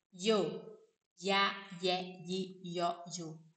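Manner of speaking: yo, ya, ye, yi, yo sounded out one by one, each syllable starting with a y sound; Spanish LL sound. Shown as a demonstration. Teacher shows Brazilian students how LL sounds, in yo, ya, ye, yi, yo. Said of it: "Sempre que tiver esse L L".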